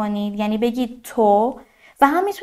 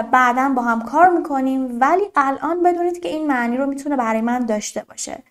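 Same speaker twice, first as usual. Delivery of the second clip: ordinary talk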